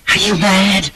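high, furious reproach; angry woman's incredulous demand; hissed. A narrator's reading. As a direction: hiss it in outrage, pitched very high. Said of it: "she hissed".

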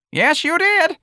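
put-on very high, loud piping old woman. A narrator's reading. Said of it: "Miss Trixie piped".

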